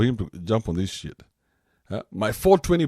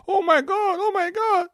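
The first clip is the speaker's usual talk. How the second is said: mock French old lady; 4 dB louder than usual. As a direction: panicky French accent, much higher. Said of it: "And in the French accent, of course".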